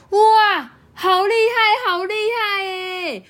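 very high, loud squeal, fading into a whimper; little ones dazzled by the show; amazed kids. Acted. Kids amazed at falling fruit.

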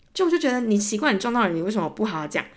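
normal talking voice

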